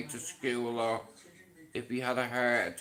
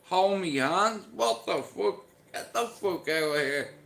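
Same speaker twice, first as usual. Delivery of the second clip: incredulous; 6 semitones higher than usual. Retold or imitated. Incredulous at dad's request.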